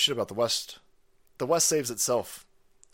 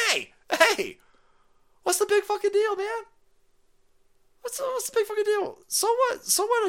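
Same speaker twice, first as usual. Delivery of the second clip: much higher mock dismissal; mocking falsetto; 4 dB louder than usual. Mocking the official brushing off the derailment, much higher. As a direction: toss it off in a squeaky shrug.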